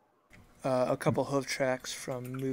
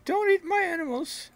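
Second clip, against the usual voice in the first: odd farmer voice; 6 dB louder than usual. Acted.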